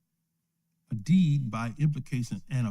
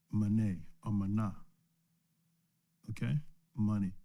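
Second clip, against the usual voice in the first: ordinary delivery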